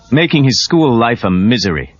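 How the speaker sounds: ordinary delivery, nothing marked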